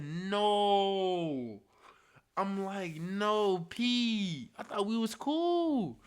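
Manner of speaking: high, loud, drawn-out wailing; hammed-up wail of betrayal; mock anguished plea. Acts out dismayed plea to friend.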